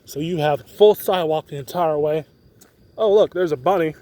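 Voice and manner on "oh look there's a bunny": ordinary talk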